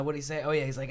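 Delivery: usual speaking voice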